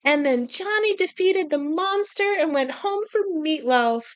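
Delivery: mock a cheesy children's story ending; storybook narrator. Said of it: "That's why I'm not like".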